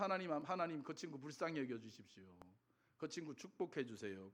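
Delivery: gentle, subdued imitated pleading; compassionate prayer. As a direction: quietly ask God's mercy for a friend. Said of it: "그러면서 기도하더라고요".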